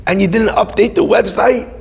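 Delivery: can't believe what he's hearing; incredulous. Retold or imitated. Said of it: "I was like".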